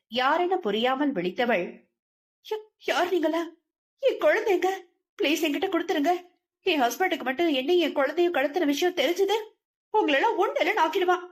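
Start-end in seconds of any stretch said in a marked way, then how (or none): 2.5-3.4 high, stammered question; stammering fright. A narrator's reading. Frightened wife stammers at the strangers, high pitched. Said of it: "ஆவேசமாக கத்தியதை". 4.0-11.3 high, fast, threatening outburst; furious shouting. A narrator's reading. Wife threatens her captors with her husband, high and furious. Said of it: "ஆவேசமாக கத்தியதை".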